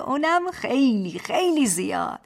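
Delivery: put-on baby talk, خیلی خیلی stretched and settling down; babyish statement. Husband teasing his wife, babyish statement. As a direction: state خیلی خیلی زیاد like a cooing child. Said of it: "با همان لحن بچگانه".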